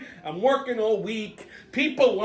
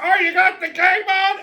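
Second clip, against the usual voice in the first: nosy guy; 10 semitones higher, 4 dB louder than usual. Retold or imitated. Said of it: "the guy next to me".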